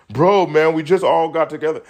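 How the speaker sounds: high, loud mocking bro voice; a hammed-up bro whining an excuse; mock dude voice. Mocking a guy who defends the girl group, high and loud.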